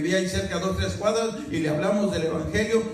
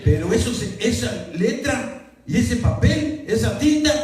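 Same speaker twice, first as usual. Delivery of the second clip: building louder and higher toward the climax; rising preaching fervor; 5 semitones higher, 5 dB louder than usual. Real, not acted. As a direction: preach it with mounting fire, slowing down. (predicador cada vez más fuerte y agudo, con fervor)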